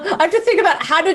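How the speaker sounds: chuckling through the words; giggling while talking; laughing. Real, not acted.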